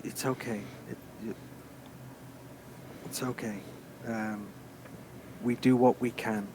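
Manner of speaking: soft, hushed reassurance; gentle comfort. Acted. Comforting the sobbing father over his son, soft and hushed. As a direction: murmur it to calm him. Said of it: "certainly, like".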